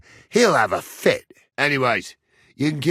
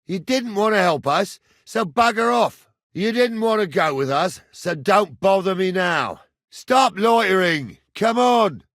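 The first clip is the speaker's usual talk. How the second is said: high, raised scolding; angry dismissal; 6 semitones higher than usual. Acted. Man dismissing someone who wouldn't help, scolding angrily. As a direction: send the lad packing, voice raised. Sending off an unhelpful lad.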